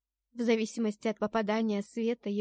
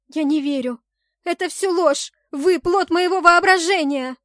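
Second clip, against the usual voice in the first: loud, high-pitched shouting, building; frantic accusing outburst; desperate denial; 7 semitones higher, 11 dB louder than usual. A narrator's reading. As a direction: cry out against what you are shown. Heroine shouts down the god's vision.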